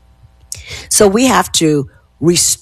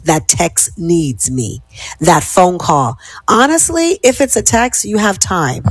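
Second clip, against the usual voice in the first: normal talking voice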